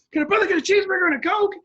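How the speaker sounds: high, loud, rapid-fire demand; exasperated yell. Retold or imitated. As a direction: yell it fast at someone ignoring you. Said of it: "and I yell out".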